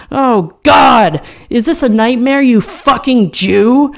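furious outburst. A narrator's reading. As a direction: spit out the insult in disgust, slowing at the end. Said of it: "I mutter".

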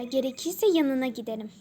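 ordinary talk

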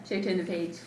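ordinary delivery

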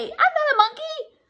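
high-pitched mock outrage; indignant protest. Reader objects to being called a monkey, high mock outrage. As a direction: deny it hotly, way up high.

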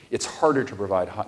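normal talking voice

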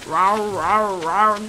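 low mock rumbling rawr; low, rounded growly rawrs; imitated stomach growl. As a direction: imitate a hungry sky noise. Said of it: "that noise that's going in the sky".

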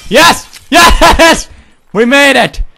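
high, loud victory shouts; triumphant yelling. Acted. Player clearing the obstacle, shouting in triumph. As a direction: whoop at the top of your voice. Father celebrates.

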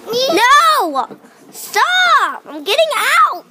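high, loud protest; yelling stop. Real, not acted. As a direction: shout to be let out. Yelling trapped in seat.

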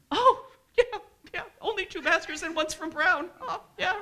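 very high, loud, laughing mockery; mocking disbelief. Speaker reacts to a boast of two masters, loud laughing mockery. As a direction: echo the brag back way up high, incredulous.

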